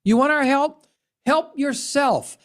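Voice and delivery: ordinary delivery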